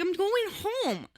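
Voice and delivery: high, slow, small-voiced reply; drawn-out, high, mock-innocent answer; meek protest. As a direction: imitate yourself answering a cop innocently. Retelling her meek answer to cop.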